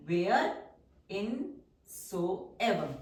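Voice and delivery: very drawn-out, syllable by syllable; slow dictation. Shown as a demonstration. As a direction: stretch it out for the class to write. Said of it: "teacher dictates word slowly". (बहुत धीमे खींचकर बोलना)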